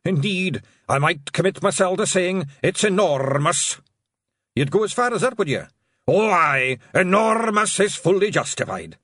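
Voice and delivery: high, loud pixie brogue; mock-formal Scots deadpan; Scots pixie banter. Pixies chatting about the whale, high Scots voices.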